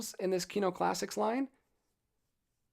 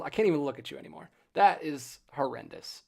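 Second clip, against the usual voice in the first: normal talking voice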